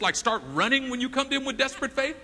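ordinary talk

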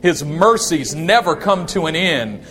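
slow, loud and emphatic declaration; nice and loud. Shown as a demonstration. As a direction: lead the congregation, slow and loud. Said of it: "Say it nice and loud".

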